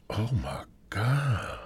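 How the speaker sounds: soft stunned oh my God; awed gasp. Real, not acted. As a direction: breathe it out amazed. Reaction, crying sound heard.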